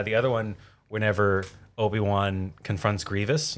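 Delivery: ordinary talk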